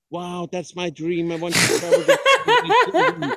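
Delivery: high, gushing excited voice. Retold or imitated. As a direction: squeal it like a dream come true. People dreaming of world travel.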